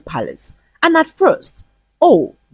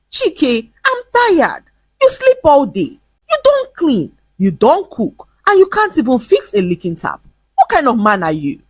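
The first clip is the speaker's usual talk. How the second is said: angry tirade; 7 semitones higher than usual. A narrator's reading. Pregnant wife confronting lazy husband, high angry scolding. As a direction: scold him with rising outrage.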